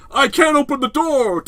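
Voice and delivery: high, loud, slow mock panic; hammed-up struggling heroine; hokey distress. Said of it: "still gets very hokey at times".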